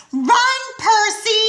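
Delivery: high, loud, drawn-out yell; shouting. A narrator's reading. Annabeth shouting at Percy to run. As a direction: urge him to flee at full voice. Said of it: "Annabeth's voice shouted".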